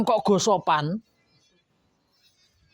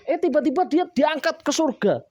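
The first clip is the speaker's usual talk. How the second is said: usual speaking voice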